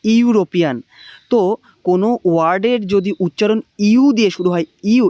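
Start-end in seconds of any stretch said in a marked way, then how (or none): 0.0-0.8 ইউরোপিয়ান spoken crisply, starting on a "you" sound; pronunciation demo. Shown as a demonstration. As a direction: model ইউরোপিয়ান for the class to repeat, loud and clear. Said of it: "উচ্চারণ করো ইউরোপিয়ান".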